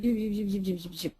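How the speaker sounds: trailing off into mumbled zoops. Retold or imitated. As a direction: let the words sink and fade to nothing. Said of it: "and then he went".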